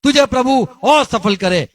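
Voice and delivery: high and loud, a blessing that jabs; a loud mock blessing aimed at the listener; taunting blessing. Shown as a demonstration. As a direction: say it to rub it in. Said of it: "सुनने वाले को कभी सुनाने के लिए".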